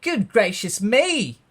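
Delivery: high, loud, drawn-out astonishment; hammed-up motherly alarm; shocked exclamation. A narrator's reading. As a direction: exclaim it slowly in great shock. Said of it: "and exclaimed".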